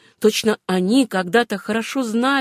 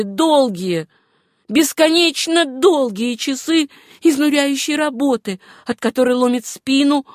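higher, insistent woman's voice; Suzanne's voice; 5 semitones higher, 3 dB louder than usual. Acted. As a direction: press each hardship home in a raised voice. Героиня романа обличает тяжёлую жизнь. (высокий, настойчивый голос героини)

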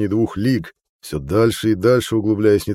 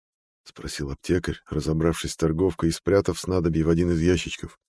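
ordinary delivery, nothing marked

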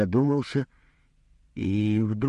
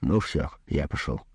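low offhand sign-off; casual, offhand; 6 semitones lower than usual. A narrator's reading. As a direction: toss it off carelessly. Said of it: "небрежно произнёс". (небрежно, между делом)

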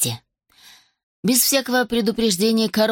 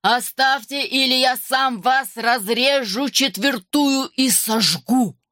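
slow, rolling dragon threat; put-on thunderous menace, drawn out; rumbling bass; 4 semitones higher, slower than usual. A narrator's reading. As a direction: boom it out slowly, syllable by syllable. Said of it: "глухой раскатистый бас".

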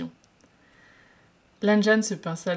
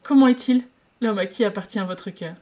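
sobbing; 3 semitones higher, 8 dB louder than usual. Acted. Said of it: "il demanda en sanglotant".

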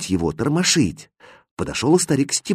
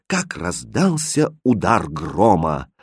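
ordinary delivery, nothing marked

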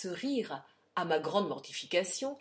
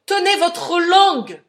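full-force high, slow shout; furious shouted order; 10 semitones higher, 17 dB louder than usual. A narrator's reading. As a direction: roar the order, stretching every word. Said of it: "criait-il". (ordre hurlé avec fureur)

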